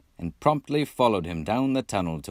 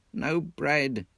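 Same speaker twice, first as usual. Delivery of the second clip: groaned dolorously; 6 semitones higher than usual. Acted. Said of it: "groaned the Mole dolorously".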